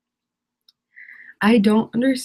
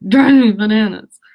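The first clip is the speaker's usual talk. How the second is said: a louder, fed-up burst of drives me bananas; loud exasperation; 8 dB louder than usual. Real, not acted. Venting to stream viewers about Instagram themes, louder fed-up outburst. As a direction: let the annoyance boil over, louder.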